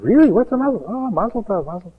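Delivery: high, mock-innocent surprise; feigned ignorance. Secretary to congratulating friends, high mock surprise. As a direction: act like you're just hearing it. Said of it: "he said".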